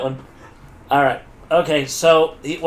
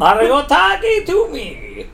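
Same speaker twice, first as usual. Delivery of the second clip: put-on voice; 8 semitones higher, slower than usual. Shown as a demonstration. Voice range on one line.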